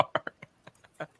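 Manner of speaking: breathless whispered punch-setup word; whispered end. Acted. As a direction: drop to a whisper on the last word. Whispered joke setup ending.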